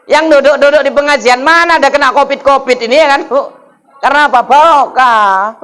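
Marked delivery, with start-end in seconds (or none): 4.4-5.6 drawn-out blessing. Shown as a demonstration. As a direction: stretch out barokah long and slow, rising at the end. Preacher drawing out a blessing. (Barokah diucapkan panjang dan pelan, nada naik)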